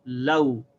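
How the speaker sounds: "law" with la gliding into w; "law" said as one syllable; demo syllable law. Shown as a demonstration. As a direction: add the w onto "law". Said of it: "You see how that's pronounced?".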